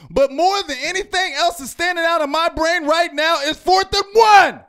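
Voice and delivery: frustrated shout that peaks on the last words; real yelling. Real, not acted. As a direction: blow up about the fourth and one. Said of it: "I'm sorry for yelling".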